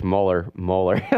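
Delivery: Mueller said slowly as it is spelled, like molar; drawn-out Mueller read as molar, the way it was typed; spelled-out pronunciation. Shown as a demonstration. Host reads the typed name Mueller slowly as molar. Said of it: "typed in Mueller literally as it's spelled".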